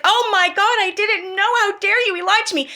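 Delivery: mock her as a hysterical crybaby; shrill mocking parody of a live-stream meltdown; mocking whiny imitation. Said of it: "and cry, and freak out, and be like".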